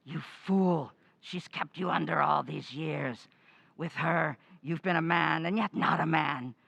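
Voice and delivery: loud, scornful ghost rant; contemptuous scolding. Acted. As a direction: lash him with contempt. Ghost berates the husband.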